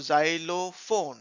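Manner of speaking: xylophone slowly in parts, zai-lo-phone; teacher sounding out "xylophone"; syllable by syllable. Shown as a demonstration. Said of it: "छोटे-छोटे parts में उसको".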